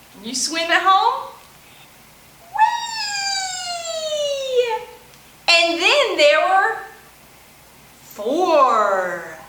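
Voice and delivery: bouncy, sing-song counting rhyme with whoops; playful children's finger-play chant; storytime action rhyme. Acted. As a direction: chant it for little kids doing the actions. Chants five perky penguins rhyme.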